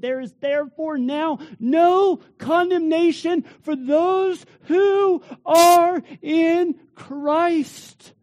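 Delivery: loud, high, triumphant proclamation; preaching at full force. Acted. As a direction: proclaim freedom from guilt to the congregation.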